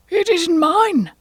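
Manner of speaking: very high, loud, drawn-out protest; Hatter. Acted. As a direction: protest slowly in a high, nervous voice.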